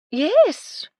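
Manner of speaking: very high loud exclaim; dotty old lady. Acted. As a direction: trill it like a dowager. Widow exclaims.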